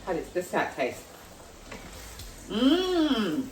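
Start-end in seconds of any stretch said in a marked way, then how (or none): 2.5-3.4 high drawn-out mmm of pleasure; savouring mmm. Real, not acted. Tasting her cooking, a high delighted mmm. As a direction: hum with delight at the taste.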